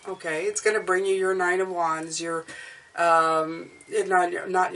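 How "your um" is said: usual speaking voice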